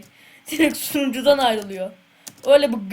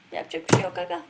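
ordinary delivery

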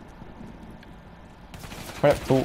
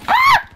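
very high loud scream; high mock shriek; shrill mock scream; 10 dB louder than usual. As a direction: shriek as if jump-scared.

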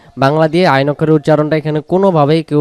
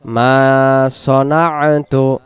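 ordinary talk, nothing marked

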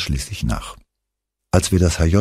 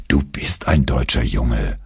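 lower, slow and gentle, a hint of a kind teacher; sympathetic; 6 semitones lower than usual. A narrator's reading. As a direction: soften it, concede it kindly and slowly. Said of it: "räumte Herr Schürmann mitfühlend ein".

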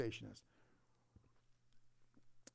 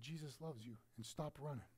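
much softer, tender pastor voice; soft quote; 3 semitones lower, 12 dB quieter than usual. Retold or imitated. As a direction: say it softly, almost private. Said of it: "and said".

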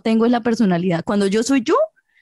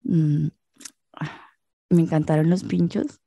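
mock sexy voice; 3 semitones lower, slower than usual. Shown as a demonstration. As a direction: hum first, then murmur it low. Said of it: "como cucuteña, como le digo yo".

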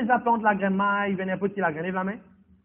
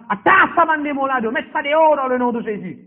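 loud and higher, casting the demons out; commanding rebuke of demons; 4 semitones higher, 4 dB louder than usual. Real, not acted. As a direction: attack it, drive them out with full force. Ordre contre les démons. (ordre crié contre les démons)

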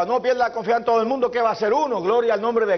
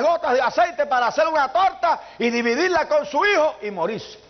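fervent preaching; 7 semitones higher than usual. Acted. Raised preaching pitch on Elijah.

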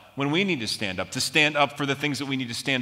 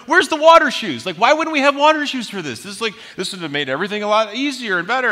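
high mock whine, much higher; whining; 9 semitones higher, 7 dB louder, faster than usual. Complaining to his buddy Mike about the rocky beach, mock whine. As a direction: whine like a sulky kid. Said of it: "I'm whining, I'm complaining".

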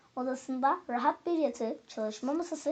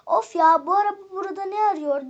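loud, higher exasperated complaint; annoyed grumbling; 5 semitones higher, 8 dB louder than usual. A narrator's reading. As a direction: grumble crossly at finding it there. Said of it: "diye söylenmeye başlamış". (sinirle söylenerek)

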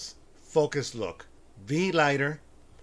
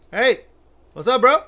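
loud, high greeting; calling out; 12 semitones higher, 15 dB louder than usual. Real, not acted. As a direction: shout to get his attention. Calls to the stranger again.

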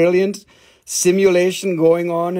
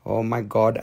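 ordinary talk, nothing marked

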